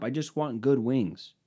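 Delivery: ordinary delivery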